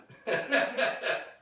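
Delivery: high breathy laugh. Real, not acted. Laughing at his own story, high and airy. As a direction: laugh out at the punchline.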